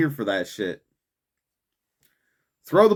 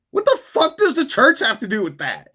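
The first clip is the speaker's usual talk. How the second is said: incredulous outburst; 10 semitones higher, 4 dB louder than usual. Real, not acted.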